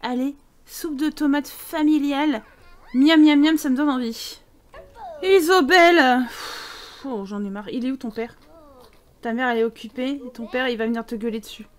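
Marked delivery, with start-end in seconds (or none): 3.0-3.6 « miam » fort et gourmand; gourmandise. Real, not acted. Tomato soup cooked. 5.2-8.4 appel traîné puis soupir las; mère au bout du rouleau; mère excédée. Pretend. Voices exasperated mother.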